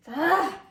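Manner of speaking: short, loud cry of dismay; exasperated "ah!". Real, not acted. Cook seeing it not done, exasperated cry. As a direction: let out a sudden frustrated "ah!". Dismayed at food falling apart.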